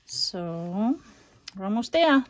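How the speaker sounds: ordinary talk, nothing marked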